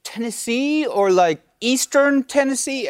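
high, lightly British-tinged host voice. Retold or imitated. British host asking writers about Tennessee, in a mild British accent. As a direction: play a keen British actor asking earnestly. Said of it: "one particular guy was like".

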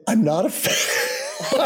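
high, slow, laughing it out; amused correction through laughter; mock indignant, laughing. Retold line to Michael, laughing.

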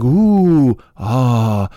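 drawn-out fake oohing and aahing; mock awe. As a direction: fake being dazzled. Mocking an impressed audience.

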